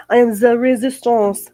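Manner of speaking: mock French declaration; terrible French accent. Shown as a demonstration. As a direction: put on a bad French accent, RESISTANCE as "ray-zee-STAHNSS". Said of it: "my terrible French accent".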